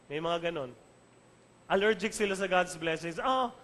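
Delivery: ordinary talk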